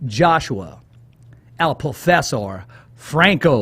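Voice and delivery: drawn-out, suspenseful guest introduction; slow ring-announcer build to the name. Real, not acted. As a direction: build anticipation with long pauses. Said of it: "say it real slow so we can anticipate".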